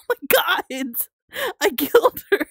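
high, loud laughing shriek; shocked laughing cry. Real, not acted. Streamer shrieks after killing the grandma, laughing. Knocked over grandma in game.

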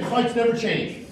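ordinary talk, nothing marked

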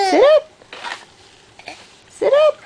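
laughing high encouragement; high coaxing. Real, not acted. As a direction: cheer him up to sitting. Coaxing baby to sit up.

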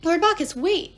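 loud, urgent plea; protesting call. A narrator's reading. Jason calls to the vanishing god, loud urgent protest. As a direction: call out after him to stop. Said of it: "Jason protested".

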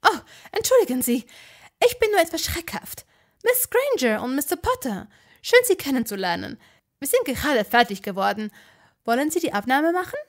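fussy, eager builder-witch welcoming guests; high, eager witch voice; flustered ministry witch. A narrator's reading.

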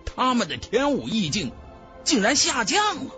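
ordinary delivery, nothing marked

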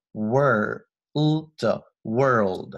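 "world" 拆成 wer-ld，L 读清楚; 把 "world" 拉得很慢，突出里面的 L; 慢速拆音示范. Shown as a demonstration. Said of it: "有个L在里面". (放慢拆读 "world"，带出 L 音)

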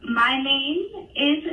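slow, deliberate, stretched introduction; slow and clear. Real, not acted. Telemarketer starting over for the customer, very slow. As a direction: say each word separately and draw it out. Caller obeys nice and slow.

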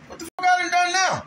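high shouted what-did-I-do; exasperated disbelief. Retold or imitated. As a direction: yell it like you can't believe it. His own exasperated reaction.

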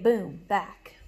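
ordinary delivery

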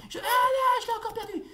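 high, loud cry of losing the ball; frustrated shout. Real, not acted. Loses ball again, shouting.

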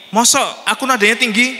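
high, loud protest that she isn't shouting; indignant denial. Retold or imitated. In-law protesting about tone, high and loud. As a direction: bristle back, voice shooting up. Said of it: "kayak orang marah".

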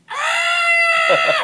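a loud, high cartoon scream; high mock shriek. Comic recreates the puppet head's scream for the host, loud and high. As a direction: shriek like a flying head.

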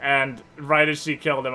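ordinary talk, nothing marked

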